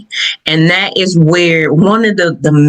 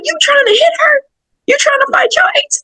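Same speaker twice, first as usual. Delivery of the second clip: much higher, fast mock scold; scolding relatives; faster than usual. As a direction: play the shocked family scolding her. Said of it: "they were like".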